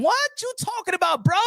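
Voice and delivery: high, disbelieving outburst; can't believe what he heard; incredulous. Real, not acted. Disbelief at the opponent's argument.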